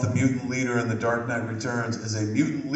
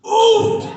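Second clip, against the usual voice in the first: high loud pained oof; hammed-up oof; 10 dB louder than usual. Hit in the crotch.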